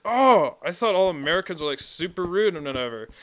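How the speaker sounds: high, exclaimed imitation of surprise; mock surprise. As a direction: retell it with a big, loud "Oh". Retelling a surprised Australian's reaction.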